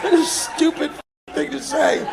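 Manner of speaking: loud, high self-mocking through laughter; laughing shout. Real, not acted. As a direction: yell it at yourself, laughing.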